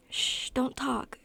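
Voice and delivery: slow, soothing shush; gentle hushing. A narrator's reading. Kenny quiets a girl struggling to breathe, gently hushing. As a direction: hush someone tenderly.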